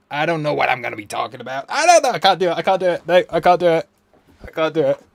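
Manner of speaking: high, loud, floundering American accent; bad American accent. Shown as a demonstration. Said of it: "that was the worst American accent I've done".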